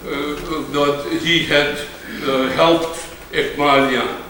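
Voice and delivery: ordinary talk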